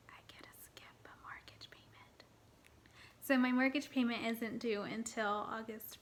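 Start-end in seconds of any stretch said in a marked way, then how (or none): 0.1-2.2 breathy secret whisper, almost no voice. Real, not acted. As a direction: let it slip like a delighted secret. Shares a happy money secret.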